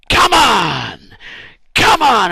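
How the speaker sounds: loud, high rallying yell; cheering shout. Retold or imitated. Moses urging his army on, loud high cheering yell. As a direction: bellow encouragement at the battle. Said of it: "And he's going like this".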